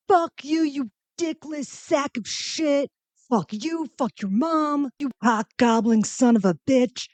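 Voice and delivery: high mock screaming of insults; retold blind rage. Comedian reliving swearing at John C. Reilly, high mock rage. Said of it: "I was in a blind rage".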